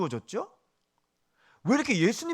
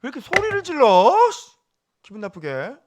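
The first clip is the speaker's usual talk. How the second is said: mock irritated listener, loud and high; annoyed complaint; 5 semitones higher, 8 dB louder than usual. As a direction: grumble back at the shouting preacher. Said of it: "제가 이렇게 소리 지르는 거 있잖아". (짜증 난 듯 흉내 내는 말투)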